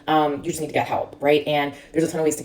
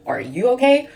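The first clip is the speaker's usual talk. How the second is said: concerned question; 8 semitones higher, 4 dB louder than usual. Retold or imitated. Stranger checks on her, concerned.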